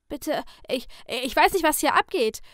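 high, loud frightened stammer; panicked young man pleading. A narrator's reading. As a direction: stumble over the words in panic. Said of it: "stammelte der junge Mann".

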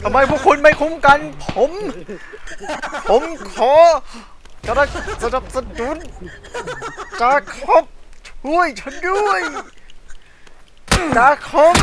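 high loud pleading for cover; hammed-up dying soldier; desperate soldier. Wounded soldier begging for help.

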